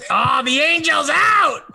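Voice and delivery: high, drawn-out crowing call; excited hollering. Real, not acted. Host hailing the guest's outburst, excited hollering.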